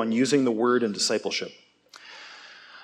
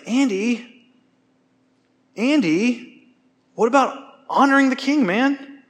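much higher gentle appealing voice of a friend; imitating friend gently; 10 semitones higher, slower than usual. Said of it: "He's like".

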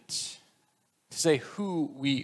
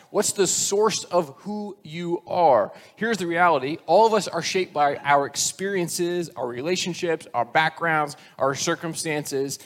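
ordinary talk, nothing marked

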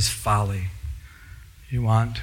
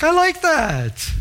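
slow approving reply; 13 semitones higher, 3 dB louder, much slower than usual. Retold or imitated. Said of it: "And I'm like".

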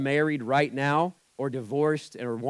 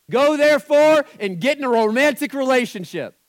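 much higher, louder mock proclamation; mock misquote; 11 semitones higher, 11 dB louder than usual. Shown as a demonstration. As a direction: boom out the fake verse. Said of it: "don't misquote scripture".

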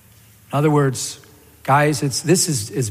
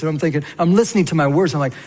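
ordinary talk

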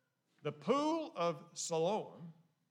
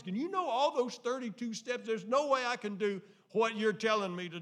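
loud, high protest of refusal; protesting blind man; 7 semitones higher, 8 dB louder than usual. Acted. Preacher voicing the blind man's loud, high refusal to Jesus. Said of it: "it would have been real easy for him to say".

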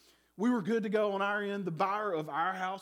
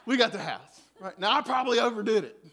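laughing; 4 semitones higher, 9 dB louder than usual. Real, not acted. Laughing excited story climax.

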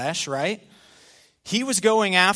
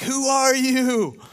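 frightened Saul; 8 semitones higher, slower than usual. Acted. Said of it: "and he's like".

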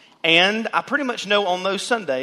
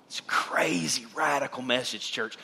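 whispered, softer awe at the message; hushed awe; 4 semitones lower, 6 dB quieter than usual. Real, not acted. Preacher to congregation, a soft whisper of awe. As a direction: lean in and whisper it to the church.

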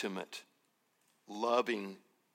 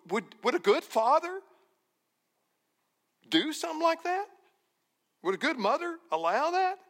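much higher and faster, disbelieving; preacher voicing Jesus, rising high in incredulity; incredulous question; 11 semitones higher, faster than usual. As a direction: push the voice up high in mock disbelief. Jesus voiced asking an absurd question.

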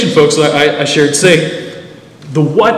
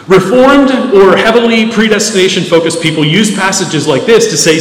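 usual speaking voice

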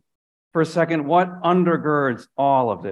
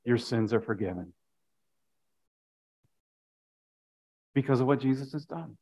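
quiet assurance; 8 semitones lower, 11 dB quieter than usual. Real, not acted. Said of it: "So I'm gonna say it".

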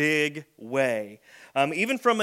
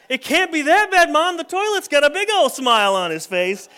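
put-on much higher, louder kid voice; pleading kid; 10 semitones higher, 10 dB louder than usual. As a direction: talk mom out of it with big cheer.

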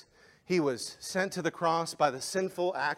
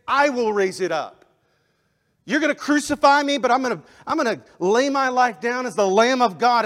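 loud, higher preaching of Jesus' words; Jesus declaring loudly; 10 semitones higher, 9 dB louder than usual. Acted. Preacher voicing Jesus to the crowd, loud and rising. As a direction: proclaim it to the crowd. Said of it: "He said".

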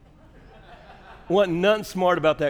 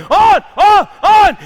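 very high, loud one-word shouts; imitated yelling wife; 15 dB louder, slower than usual. Wife calls out headlight changes from roadside, imitated yelling. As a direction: yell each call out separately, at full volume. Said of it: "So she's yelling at me".

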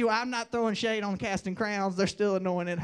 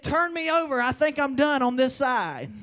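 raised-voice quote; 7 dB louder than usual. Retold or imitated. Quoting a martyr's defiant words.

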